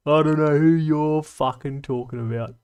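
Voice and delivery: a hammed-up second reading. Shown as a demonstration. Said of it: "That was said by Killzone".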